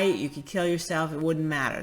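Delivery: ordinary delivery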